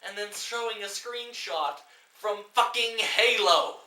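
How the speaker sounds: high, loud and slow, exasperated; incredulous shouted rant; slow outraged rant. Real, not acted. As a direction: hammer the question home in disbelief. Ranting at the film.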